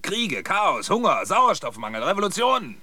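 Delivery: loud, high list of disasters; crying out. Acted. Old man recounts reports heard from Earth, crying out. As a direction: hurl out each word with rising force.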